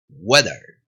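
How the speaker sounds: low "Weather" opening like Spanish "huevo". Shown as a demonstration. Teacher modelling the W sound, like huevo. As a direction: round the W of "Weather", weak final vowel. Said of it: "Si querés decir bien weather".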